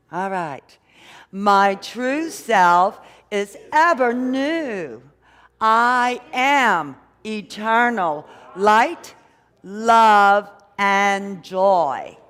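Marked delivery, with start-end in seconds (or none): 1.3-12.2 slow, loud, drawn-out declaration; affirmation said like we mean it. Shown as a demonstration. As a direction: proclaim every word with full conviction. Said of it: "say it like we mean it".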